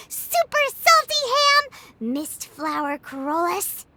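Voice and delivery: usual speaking voice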